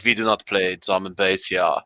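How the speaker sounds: low-key mock German; deadpan imitated German accent. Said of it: "interacting with a German".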